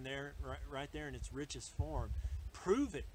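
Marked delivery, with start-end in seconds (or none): 2.5-3.0 higher, louder challenge; challenging Satan. Acted. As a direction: throw it down as a dare. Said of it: "He says".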